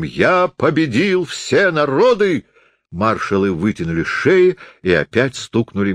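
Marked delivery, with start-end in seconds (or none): 0.0-2.4 high, slow theatrical boast, cried out; Napoleon. A narrator's reading. Stage Napoleon boasts of his conquests, crying out slowly. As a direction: declaim it grandly, drawing out each word. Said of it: "крикнул шипящим голосом".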